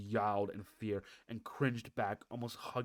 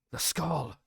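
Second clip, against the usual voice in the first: urgent exclaim; 5 semitones higher, 7 dB louder than usual. Acted. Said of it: "he whispered to Ryan and Lyria".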